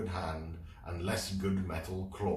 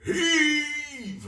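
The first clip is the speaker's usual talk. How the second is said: very high, loud final roar; 10 dB louder than usual. Acted. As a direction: roar it with all your strength. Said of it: "roared Captain Claw for the final time".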